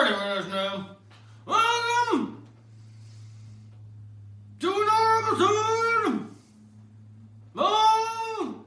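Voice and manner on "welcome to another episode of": high, booming show host intro; announcer voice. Acted. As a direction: announce it like a TV anchor. Opens the news show.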